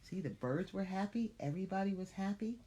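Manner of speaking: ordinary delivery, nothing marked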